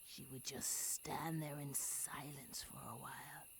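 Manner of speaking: whispered storytelling, soft and secret; low bedside whisper; hushed whisper. Acted. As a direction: breathe the words like a secret. Hushed story, tearful leaving.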